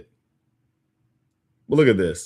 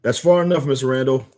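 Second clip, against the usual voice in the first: raised, high-pitched in-character warning; commanding challenge; 6 semitones higher, 4 dB louder than usual. Acted. Outrider halting Mister Randall, high commanding challenge. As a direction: call it out high and loud.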